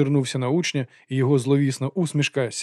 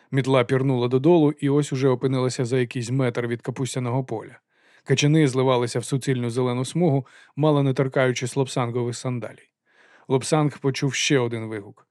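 usual speaking voice

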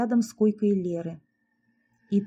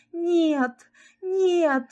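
higher, rising cries of denial; inner scream; 8 semitones higher than usual. A narrator's reading. Lera refusing the news, crying out. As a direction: scream no inside. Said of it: "кричало всё у неё внутри".

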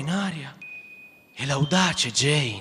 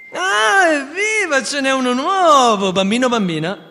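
much higher, louder, gleeful cheer; thrilled boy; 10 semitones higher, 8 dB louder than usual. Acted. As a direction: squeal with boyish delight at the news. Peter thrilled at a new child.

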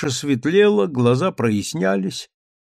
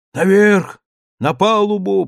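high, loud orders from the deranged keeper; crazed old man; 7 semitones higher, 5 dB louder than usual. A narrator's reading. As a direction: bark it out like a captain gone mad. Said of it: "пробормотал он".

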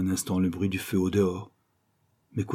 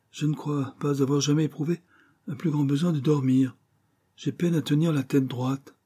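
higher-pitched lady's voice; pitched-up character line, confessing sleepiness; sleepy dinner guest; 7 semitones higher than usual. Acted. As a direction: voice a weary lady guest up high. Guest says she is sleepy.

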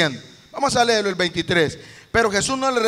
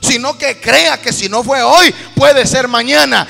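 loud, driving sermon climax; fervent preacher urging the congregation on; full-force preaching; 3 semitones higher, 10 dB louder than usual. Real, not acted.

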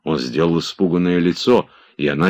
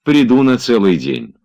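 bright higher echo, stress on приду; mocking echo; 8 semitones higher, 6 dB louder than usual. A narrator's reading. Olga teasingly echoing Oblomov's promise, mocking. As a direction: echo his promise back to tease him.

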